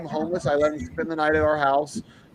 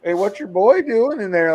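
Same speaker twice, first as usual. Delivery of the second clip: a bit higher, louder teasing call; lightly voiced joking customer; imitating a customer; 7 semitones higher, 6 dB louder than usual. Customer teasing the trainer, lightly mock joking. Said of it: "cool guys be like".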